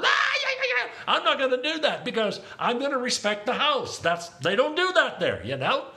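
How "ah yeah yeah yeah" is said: very high whooping shout; mock worship outburst. Preacher imitating what he won't do in a non-Pentecostal church, high mock shout. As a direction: wail out an over-the-top praise holler. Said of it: "lift my hands and go".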